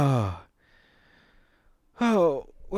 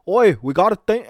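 put-on loud, higher, botched Irish accent; attempted Irish accent; 4 semitones higher, 8 dB louder than usual. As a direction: have a clumsy go at an Irish accent. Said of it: "I can't even do their accent".

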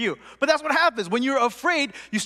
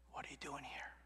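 very soft, low whisper; 8 semitones lower, 26 dB quieter than usual. Acted. God questioning Elijah up close, whispered. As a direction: whisper the question gently into his ear. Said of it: "And then he whispers to him".

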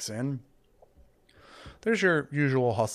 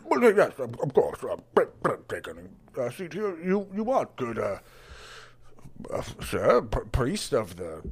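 flustered barkeep; 4 dB louder than usual. Acted. Barkeep Barry welcoming the cleric, flustered and stammering. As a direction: stumble over the welcome.

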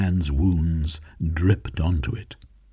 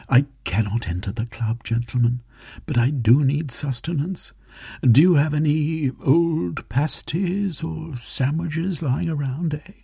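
higher, slowed, pleading request; Velveen, hungry and urgent; 9 semitones higher than usual. Acted. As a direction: ask for food under his breath.

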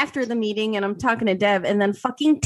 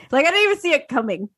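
ordinary talk, nothing marked

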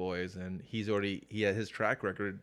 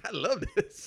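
giddy fan; 10 semitones higher, 5 dB louder than usual. Retold or imitated. Readers loving the comic.

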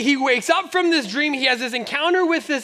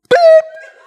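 piercing high imitated whistle blast; loud whistle beep; 12 dB louder than usual. Pastor imitates the referee's whistle, sharp high beep.

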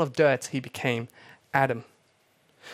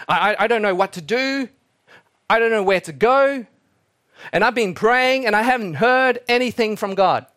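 put-on rising, louder pleading of a desperate girl; frustrated daughter; 4 semitones higher, 3 dB louder than usual. Daughter begs her pastor father, desperate and pleading. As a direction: voice climbs higher as she pleads with Dad. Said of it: "She comes into her father's office saying".

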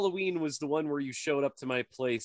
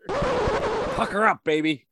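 mock seductive; 5 dB louder, slower than usual. Teasing flirt voice.

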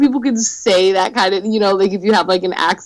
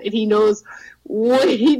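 ordinary delivery

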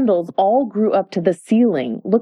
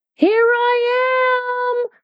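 very high, slow, drawn-out call; high cry; much slower than usual. A narrator's reading. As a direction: call out gleefully from inside the tureen. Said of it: "cried a voice from the soup tureen".

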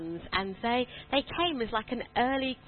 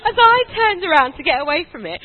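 louder, higher storytelling; emphatic restart; 6 semitones higher, 12 dB louder than usual. Real, not acted. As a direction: pick the story back up loudly over laughter. Resumes loudly over audience laughter.

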